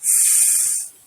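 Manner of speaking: long hissed sss; teacher modelling sss; letter sound s. Shown as a demonstration. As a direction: hiss the s sound slowly. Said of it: "I'm going to say each sound".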